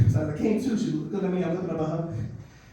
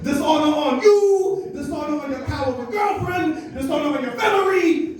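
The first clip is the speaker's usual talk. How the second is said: mock dishonor rant; 7 semitones higher, 5 dB louder than usual. Mom screams dishonor at her son over a B minus, hammed-up yelling. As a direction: ham up a furious mom's dishonor curse. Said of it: "So she's screaming at me".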